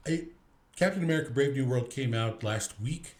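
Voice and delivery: ordinary talk, nothing marked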